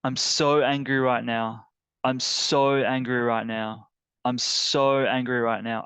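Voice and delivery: voicing anger aloud, hard stretched SO; angry venting in repeated bursts. Shown as a demonstration. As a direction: vent it, leaning long and hard on "so". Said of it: "my emotional reaction is anger".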